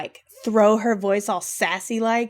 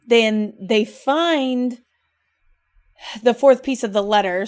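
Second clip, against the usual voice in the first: ordinary talk, nothing marked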